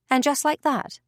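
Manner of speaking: usual speaking voice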